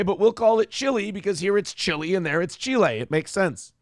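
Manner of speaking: usual speaking voice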